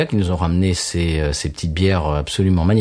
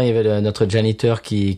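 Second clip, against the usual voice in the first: normal talking voice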